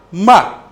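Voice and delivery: "Ma" short, starting high and falling sharply; fourth tone. Shown as a demonstration. Teacher demonstrates the last tone, falling "Ma". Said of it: "le dernier ton".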